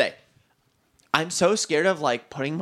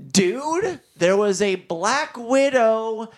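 drawn-out, slow, louder punchline delivery; dramatic reveal; 4 semitones higher, 4 dB louder, much slower than usual. Real, not acted. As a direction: stretch out each word for the big reveal. Climax reveal of the spider story.

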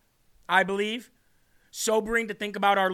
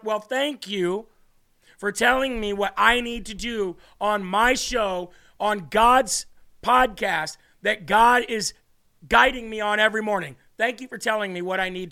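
deliberate mock gratitude, stressed word by word; pointed sarcastic thanks; 4 dB louder than usual. Real, not acted. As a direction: spell it out pointedly to your critics. Sarcastic reply to critics' scolding.

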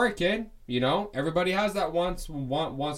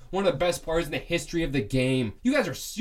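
normal talking voice

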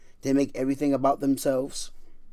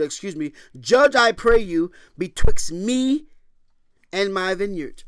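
high, loud impassioned sermon; fervent preaching; 7 semitones higher, 6 dB louder than usual. Acted. Preacher to congregation, impassioned preaching. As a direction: preach it with fire.

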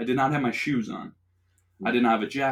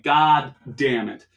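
resigned curse; 2 semitones higher, 8 dB louder, much slower than usual. Retold or imitated. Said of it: "And I'm like".

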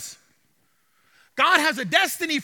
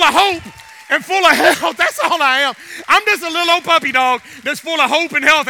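loud, driving sermon delivery; fiery pulpit push; preaching at full force; 8 dB louder than usual. Acted. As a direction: preach it hard to the room.

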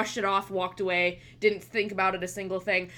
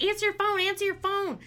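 high-pitched urgent nagging, retold; 11 semitones higher than usual. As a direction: imitate someone urging her to pick up. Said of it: "she said it very urgently".

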